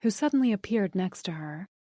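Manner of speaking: usual speaking voice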